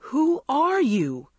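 high, drawn-out demand; alarmed, slow high exclamation; crying out. A narrator's reading. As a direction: cry it out, stretching ARE. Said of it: "Andrea cried to Gina".

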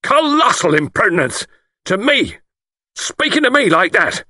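high, loud explosion of outrage; exploding. A narrator's reading. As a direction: explode with wounded dignity. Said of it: "Lord Whitfield exploded".

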